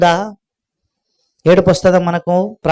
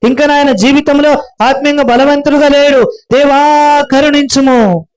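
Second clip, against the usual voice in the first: raised, fervent intercession; a preacher voicing a believer's earnest prayer; pleading prayer; 4 semitones higher than usual. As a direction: plead with God for others.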